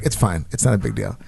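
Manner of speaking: resigned and quiet, waving it away. Retold or imitated. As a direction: brush it off quietly, pitched low. Retold reply to flight attendant.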